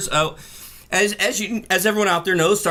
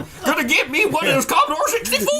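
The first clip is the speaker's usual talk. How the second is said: put-on high cackly wheezy old timer; old prospector voice; 10 semitones higher than usual. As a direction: cackle like a gold-rush miner. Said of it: "An old prospector voice".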